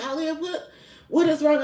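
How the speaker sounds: high-pitched, alarmed questioning; imitated worried coworker.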